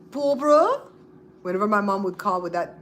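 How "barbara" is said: high, loud calling "Barbara?" with a rise; mom calling her name. Retold or imitated. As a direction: call her like a mother wanting something. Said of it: "like my mom used to say".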